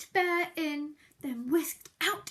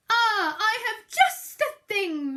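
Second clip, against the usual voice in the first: high, louder bright Mouse; announced; 5 semitones higher, 8 dB louder than usual. Acted. Mouse announcing a remedy, bright and eager. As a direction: announce it gleefully. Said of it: "Mouse announced".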